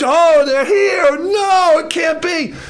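high, loud cry of disbelief; panicked cry. Acted. Midianite seeing Gideon's men arrive, acted high panic. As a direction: wail it in mock terror.

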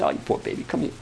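put-on high, soothing baby-talk pity; hammed-up "you poor baby" coddling; mock pity. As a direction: coo like someone babying a crybaby. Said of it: "I wanted somebody to say".

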